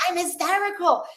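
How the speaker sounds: high-pitched mock hysterics; hammed-up panicky movie wail; Gene Wilder. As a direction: imitate a hysterical film character. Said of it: "And then he goes".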